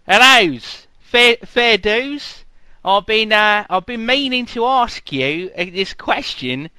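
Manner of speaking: bad Australian accent. Fan quizzing a panel guest, in a hammed-up Australian accent. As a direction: play a fan with an over-the-top Australian twang. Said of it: "Am I Australian?".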